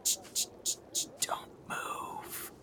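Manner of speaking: whispered shushing that fades away; hushing whisper. Acted. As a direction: hush them without a breath of voice. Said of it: "He's like".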